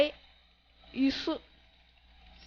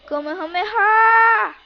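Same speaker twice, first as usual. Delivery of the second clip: loud, high battle cry; attack shout; 5 semitones higher, 7 dB louder than usual. Acted. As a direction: belt out the attack name. Calling out special attack.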